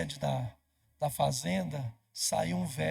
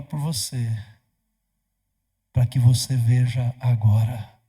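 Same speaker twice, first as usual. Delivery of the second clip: loud, lower pulpit proclamation; full-force preaching; 4 semitones lower, 10 dB louder than usual. Acted. Ananias speaking to Saulo, preached loud and low. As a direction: thunder it out to the church.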